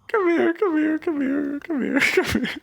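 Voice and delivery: high, cooing comfort; soothing. Real, not acted. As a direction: coax her in gently like a hug. Comforting a crying friend.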